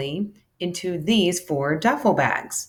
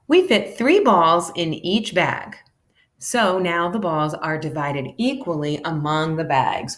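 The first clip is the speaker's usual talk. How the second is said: ordinary delivery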